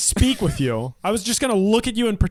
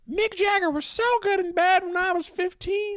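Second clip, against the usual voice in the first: mock mom voice; 10 semitones higher than usual. Mocking mom's confession.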